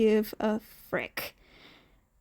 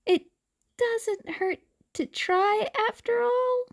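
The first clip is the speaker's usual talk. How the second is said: put-on higher, fast, forced hopeful kid voice; forcibly hopeful; 10 semitones higher than usual. Hungry boy begs the man for cat food, forcedly hopeful. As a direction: push the hope a bit too hard. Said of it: "he asked with a forcibly hopeful tone".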